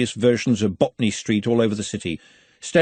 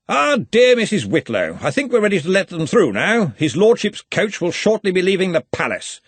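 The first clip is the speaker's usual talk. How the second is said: high, loud, commanding university head; jovial Arch-Chancellor; 8 semitones higher, 4 dB louder than usual. A narrator's reading. Arch-Chancellor hurries the housekeeper along, loud and jovial. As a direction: boom it out over the housekeeper.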